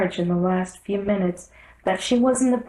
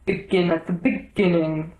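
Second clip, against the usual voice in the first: a lightly voiced solemn king; measured, serious instruction; king gravely directing the rabbit. A narrator's reading. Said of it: "the king said gravely".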